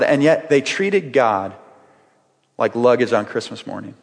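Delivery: usual speaking voice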